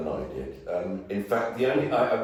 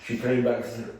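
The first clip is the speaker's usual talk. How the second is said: ordinary delivery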